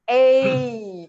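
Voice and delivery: A drawn out, gliding into E, loud and high; diphthong. Shown as a demonstration. Teacher showing two vowels together, A gliding into E. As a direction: stretch A so it slides into an E sound. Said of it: "It's actually the A E".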